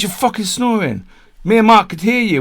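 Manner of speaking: high, loud imitated complaint; exasperated insisting. Rich insisting on the phone that he is snoring, exasperated. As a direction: hammer home the accusation.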